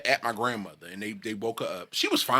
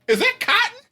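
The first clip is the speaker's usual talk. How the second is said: shocked; 4 dB louder than usual. Retold or imitated. Shocked question at funeral.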